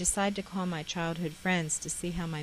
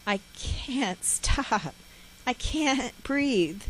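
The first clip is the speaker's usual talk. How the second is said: very slow, higher, broken by sobs; crying; 6 semitones higher, 3 dB louder, much slower than usual. Retold or imitated. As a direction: gasp it out between sobs. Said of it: "I kept crying and saying".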